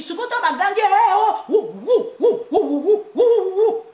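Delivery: high loud hooting wou wou; mock crowd jeering. She imitates the crowd jeering the president, loud high hooting. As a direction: hoot like a rowdy crowd.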